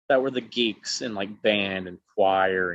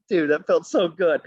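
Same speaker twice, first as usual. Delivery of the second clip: gleeful triumph; 7 semitones higher, 4 dB louder than usual. Retold or imitated. His gleeful aside to buddy Jeff.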